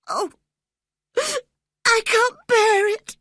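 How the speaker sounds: high, crying plea; sobbing. A narrator's reading. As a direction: sob it out, near breaking. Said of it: "he sobbed".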